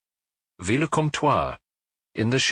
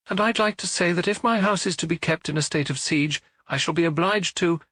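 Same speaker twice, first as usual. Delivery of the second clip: high, offended landlady voice; lightly voiced indignant landlady; 9 semitones higher than usual. A narrator's reading. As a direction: bristle with wounded pride. Indignant landlady protests to police.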